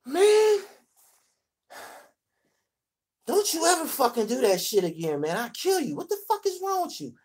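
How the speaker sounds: high, excited startled outburst; retold shock at being pranked; startled yelling at a friend. Startled by old friend Clyde.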